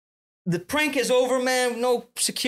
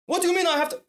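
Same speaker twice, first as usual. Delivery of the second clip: high, incredulous protest; mock outrage, voice pitched way up; imitated indignant objection; 12 semitones higher, faster than usual. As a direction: mimic a man outraged at being ordered off. Acted Armenian objects to cop's order.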